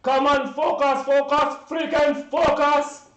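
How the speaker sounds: loud barked orders; captain screaming. Retold or imitated. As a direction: yell at the crew to keep going. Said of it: "Our captain screamed at us".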